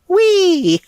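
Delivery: high, loud cartoon whee; gleeful whee. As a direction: squeal it like a ride. Arnold shot up by jet.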